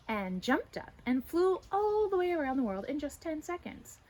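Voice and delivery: plain reading voice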